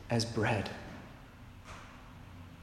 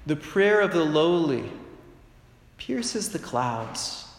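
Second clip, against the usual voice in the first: slow solemn scripture reading; 3 semitones higher, slower than usual. Shown as a demonstration. Preacher reading Sirach to the congregation, slow and solemn. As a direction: quote it like holy writ, letting each word land. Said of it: "as it said in the book of Sirach".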